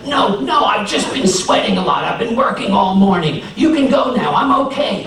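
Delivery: high, tight insisting he's fine; strained reassurance. A narrator's reading. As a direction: brush them off while hurting. Anansi hiding pain.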